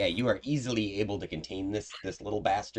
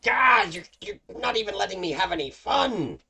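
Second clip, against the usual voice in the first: high, loud imp tantrum; whining imp; 7 dB louder than usual. Acted. Game master's imp loses his loophole, whining loudly. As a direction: complain like a thwarted little imp.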